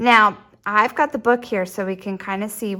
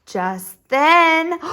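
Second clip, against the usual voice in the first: ordinary delivery, nothing marked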